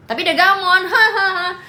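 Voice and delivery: high, loud gleeful burst into laughter; laughing. Real, not acted. Streamer gloats to viewers about the ex, laughing loudly. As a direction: crow it and break into a loud laugh.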